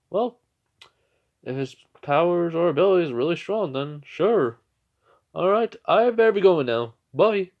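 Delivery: high, loud, hearty hero voice; hammed-up hero answer and hurried farewell; All Might. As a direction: project it brightly like a departing superhero.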